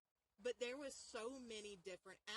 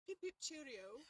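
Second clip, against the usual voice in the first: higher-pitched put-on English accent; mock British accent; 5 semitones higher than usual. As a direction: chirp it as a cartoon Brit. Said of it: "perfect English, like, acc-, not like English".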